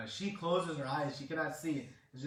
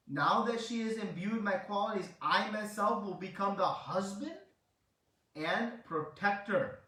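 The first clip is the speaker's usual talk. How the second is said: loud, higher-pitched solemn vow, retold; divine proclamation; 5 semitones higher, 6 dB louder than usual. Krishna vows to be her husband and protector, loud proclamation. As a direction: pledge it emphatically, slowing on the last words.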